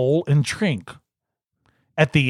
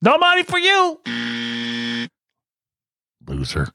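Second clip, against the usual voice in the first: teasing; 4 semitones lower than usual. Acted. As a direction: gloat in a sing-song voice. Teasing loser.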